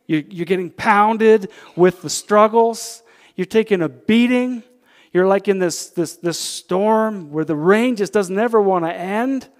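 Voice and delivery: loud, high preacher's exhortation; preaching at full force. Acted. Preacher rousing his congregation, loud full-force preaching. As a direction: lift the room, preach it out.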